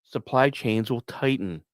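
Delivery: ordinary delivery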